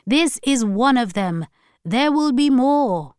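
high, solemn warning voice; preachy grandmother. A narrator's reading. Grandmother warning granddaughter of days to come, solemn. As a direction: pronounce it like a prophecy.

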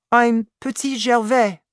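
put-on high, loud child's voice; little boy. A narrator's reading. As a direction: announce your name like a small boy. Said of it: "replied the boy".

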